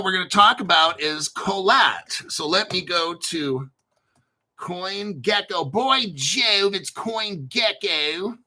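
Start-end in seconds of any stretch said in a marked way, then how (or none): 5.7-8.4 put-on British or Aussie accent, last vowel bent to "ay"; mock British accent. Crypto host playfully naming the website CoinGecko, mock British accent. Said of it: "Is that how the Aussies say it, or my, is that my British accent".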